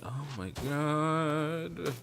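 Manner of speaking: slow, high drawn-out oh my God; exasperated groan. Retold or imitated. Clerk fed up with the customer, slow high exasperation. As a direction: drag it out in disbelief.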